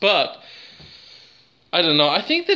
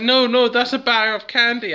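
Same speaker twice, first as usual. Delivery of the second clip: high-pitched, hammed-up pleading; mock panicked protest; 8 semitones higher, 3 dB louder than usual. Accused kid explaining the bag of candy, mock panic. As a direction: protest your innocence in pretend alarm.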